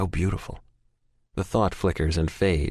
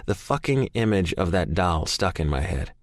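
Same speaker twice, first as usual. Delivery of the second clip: ordinary delivery, nothing marked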